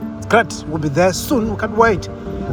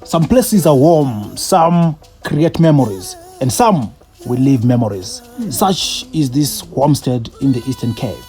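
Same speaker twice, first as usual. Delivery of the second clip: slow, measured travel narration; reflective voiceover. A narrator's reading. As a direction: tell it like a closing voiceover.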